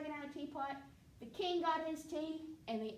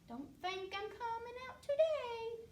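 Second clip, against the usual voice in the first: high dragon voice; 11 semitones higher than usual. Acted.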